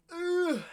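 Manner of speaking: high-pitched drawn-out ugh; long exasperated groan; frustrated groan. Real, not acted.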